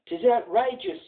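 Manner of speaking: high indignant lad's outburst; a lightly voiced boy protesting. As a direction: lad protesting he won't be branded. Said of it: "says he".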